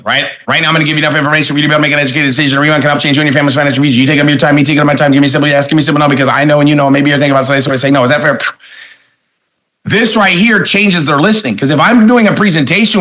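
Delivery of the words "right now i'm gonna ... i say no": very fast, well-rehearsed sales script; rapid-fire recital. Shown as a demonstration. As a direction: reel off the memorized pitch at top speed. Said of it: "I can say it over and over and over again".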